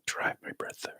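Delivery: soft, hushed meditation guidance; guided meditation whisper. Acted. Teacher guides the group through body relaxation, soft whisper.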